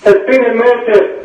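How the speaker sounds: put-on Scottish accent; lightly hammed Scottish accent. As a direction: try a Scottish catchphrase voice. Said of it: "he's doing a Scottish accent".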